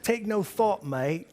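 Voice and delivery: put-on high, loud English-or-Australian accent, dropping on mate; a jokey attempt at an English or Australian accent; mock English-Australian accent. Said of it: "Is that English or is that Australian?".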